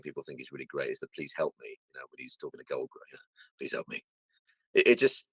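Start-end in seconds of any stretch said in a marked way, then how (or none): 1.1-1.7 low, quiet, offhand plea; throwaway line. Shown as a demonstration. Demonstrating a funny line he threw away, offhand. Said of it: "just to throw them away". 3.6-4.0 very soft, tossed-off plea; barely bother to sell the plea; throwaway line. Shown as a demonstration. Repeating the line said to Goldberg, thrown away quietly. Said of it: "just to throw them away".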